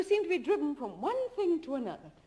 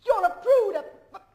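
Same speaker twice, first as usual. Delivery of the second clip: loud, high, laughing jeers; mocking insults; 8 semitones higher, 10 dB louder than usual. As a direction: fling each insult at him, laughing. She mocks him in anger.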